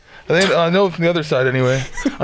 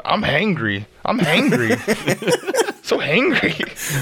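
imitating a whiny hungry chant, climbing higher; playful mock complaint, drawn out at the end; Tom; 6 semitones higher, much slower than usual. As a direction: mimic someone moaning for food, half laughing. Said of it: "Tom walks around doing that going".

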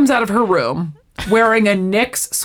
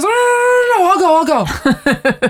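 high-pitched, louder hurried protest; mock frantic plea; 11 semitones higher, 4 dB louder than usual. Rachel stops Ross before he drinks, mock frantic. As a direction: act out stopping someone at the last second.

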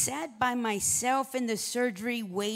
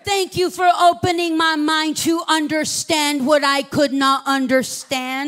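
preaching at full force; 7 semitones higher, 13 dB louder than usual. Acted. Pastor preaches the doctor's prayer to the congregation, full force. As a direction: lift the room in a fervent prayer.

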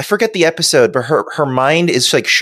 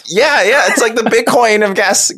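excited high voice; 9 semitones higher than usual. Real, not acted. Excited agreement.

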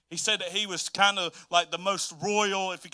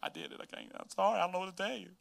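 Mary's sorry confession; 10 dB quieter, faster than usual. Retold or imitated.

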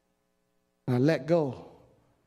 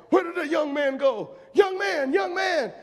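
calling preacher; 10 semitones higher than usual. Retold or imitated. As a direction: call out across the church. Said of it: "And he was like".

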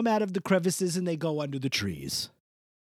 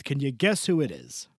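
ordinary delivery

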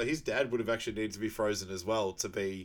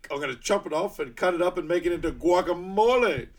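hammed-up rant; 6 semitones higher, 6 dB louder than usual. Continuing acted outburst.

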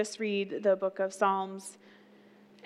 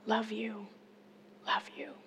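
dying aunt's soft last words; 12 dB quieter than usual. Retold or imitated. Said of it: "You know what she said?".

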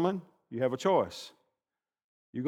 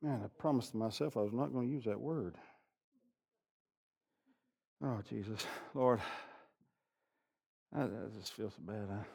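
much softer, lower self-reproach; hushed ashamed inner monologue; guilty inner voice; 5 semitones lower, 14 dB quieter than usual. Retold or imitated. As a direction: mumble it like a guilty conscience. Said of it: "You think".

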